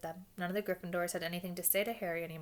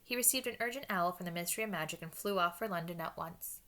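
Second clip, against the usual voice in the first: usual speaking voice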